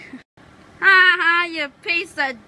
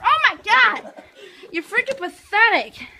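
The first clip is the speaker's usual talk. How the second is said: usual speaking voice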